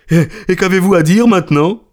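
high, gloating question; triumphant challenge. Acted. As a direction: crow over the beaten doubter. Merchant gloats over the won bet.

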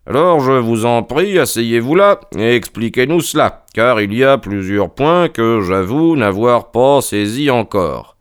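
lightly voiced detective, emphatic and measured; Holmes, courtois. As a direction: invite politely, slowing towards the end. Voices Holmes inviting explanation. (Holmes, courtois, en prenant son temps)

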